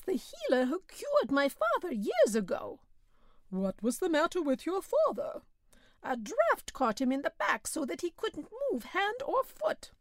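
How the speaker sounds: high, earnest peasant voice; simple peasant Belhomme. A narrator's reading. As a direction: play the naive old farmer, insisting.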